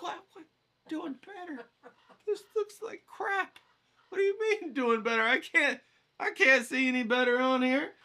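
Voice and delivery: high-pitched, stretched disbelief; incredulous disbelief. Retold or imitated. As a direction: squeak out a frustrated protest. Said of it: "I'm like".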